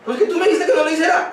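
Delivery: put-on a child hurrying to defend himself; child's retort. Child reminding the parent of his own order, lightly acted.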